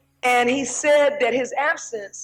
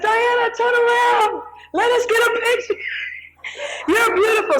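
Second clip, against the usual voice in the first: excited pleading; 9 semitones higher than usual. Retold or imitated. Calling to [name] for a picture, high eager shout. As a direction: beg her to turn for a photo. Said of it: "And I said".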